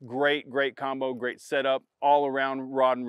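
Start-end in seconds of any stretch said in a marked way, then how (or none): none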